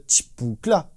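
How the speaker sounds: Chipoukla drawn out slowly like a drum fill; vocal drum fill. Retold or imitated. Drum teacher imitating the live fill, slow beatbox. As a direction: mouth the live version's fill at half speed. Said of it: "il fait juste".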